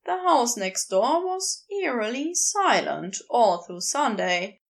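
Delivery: plain reading voice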